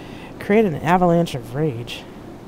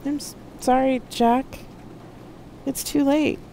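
gentle; 11 semitones higher than usual. A narrator's reading. Loki telling Jack it is too late, gently. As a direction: let the bad news down gently, high-voiced. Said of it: "Loki said gently".

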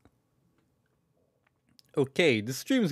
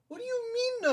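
much higher, squeaky, indignant; squeaky mock outrage; 7 dB quieter than usual. To May in chat, a squeaky mock outrage. Repeats protest even higher.

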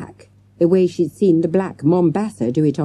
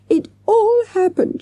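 sobbed; 4 dB louder than usual. A narrator's reading. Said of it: "she sobbed".

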